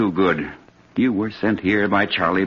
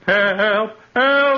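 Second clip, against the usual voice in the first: screaming for help; 12 semitones higher than usual. Acted. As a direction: shriek for help. Victim screams as count begins.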